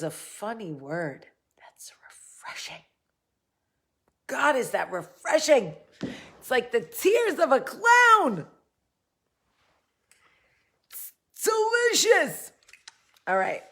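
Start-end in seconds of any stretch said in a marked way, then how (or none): 1.6-2.8 barely audible, whispered relish; soft whispered aside; hushed whisper. Pretend. Playing with a funny word. 6.4-12.5 high, loud mock ecstasy; over-the-top cry of relish; hammed-up rapture. Hammed-up praise of the drink.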